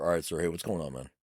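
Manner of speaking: soft, casual officer approach; calm check-in. Retold or imitated. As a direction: ease in quietly with someone who's cooled off. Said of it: "when he's calm a little bit".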